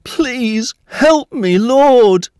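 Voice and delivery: slow, high, loud plea; begging. A narrator's reading. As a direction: beg desperately, drawing the words out. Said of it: "knelt down and begged".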